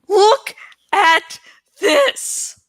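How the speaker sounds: anguished shout; screaming. Acted. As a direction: scream it in despair. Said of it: "he screamed".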